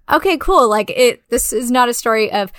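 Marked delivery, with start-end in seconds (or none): 0.1-0.7 mock approving. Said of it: "And I was like".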